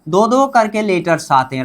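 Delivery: normal talking voice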